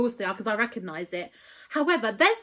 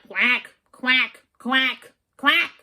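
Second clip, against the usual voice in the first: quacking like a duck, a touch louder; duck quack imitation; 3 dB louder, much slower than usual. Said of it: "he kept going like".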